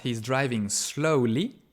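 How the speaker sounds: he's driving slowly read slowly, slowly rising; slow example reading. Shown as a demonstration. Teacher slowly reads the example sentence. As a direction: linger over slowly so they hear it. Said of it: "Alors qu'à droite".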